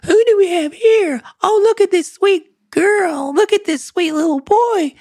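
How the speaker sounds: squeaky high cooing at a puppy; exaggerated high-pitched sweet talk to a pet; high baby talk. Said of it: "they just pitch their voice up real high".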